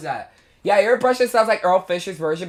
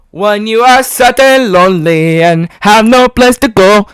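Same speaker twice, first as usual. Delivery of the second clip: Joe Sparrow impression; 3 semitones higher, 10 dB louder than usual. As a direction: belt it like an animatronic singer. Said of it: "This is Joe Sparrow".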